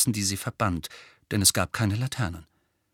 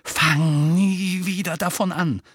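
higher, drawn-out snarl; seething, raised voice; furious warning; 7 semitones higher, 3 dB louder, slower than usual. A narrator's reading. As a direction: snarl it slowly as a threat. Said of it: "fauchte Harry".

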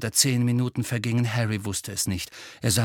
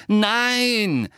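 high, loud, drawn-out cry; angry; 10 semitones higher, 5 dB louder than usual. A narrator's reading. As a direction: burst out in furious denial. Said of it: "sagte Harry zornig". (zornig, laut gerufen)

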